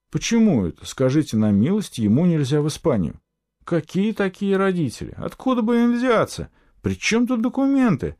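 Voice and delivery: high-pitched, indignant string of questions; rising outraged bewilderment; indignant questioning. A narrator's reading. Boy's indignant inner protest.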